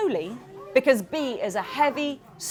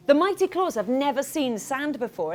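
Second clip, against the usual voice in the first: usual speaking voice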